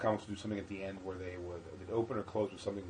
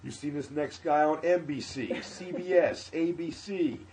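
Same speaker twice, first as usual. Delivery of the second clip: higher, slower, building announcer hype; hype-man host intro; 7 semitones higher, 7 dB louder, slower than usual. Retold or imitated. Host stalling while forgetting the name, building hype intro. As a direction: pump up the crowd like a flustered club host. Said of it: "He goes".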